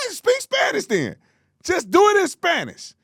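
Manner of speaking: very high-pitched exasperation; exasperated. Real, not acted. Host telling reporter to pick a language, exasperated. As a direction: plead in a squealed, high register.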